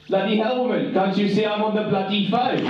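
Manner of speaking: a husband barking in a mock Zimbabwean accent. Mimicking the groom snapping at his wife, mock Zimbabwean accent. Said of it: "in the Zimbabwean accent".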